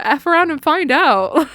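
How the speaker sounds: taunting. Real, not acted. Taunting catchphrase.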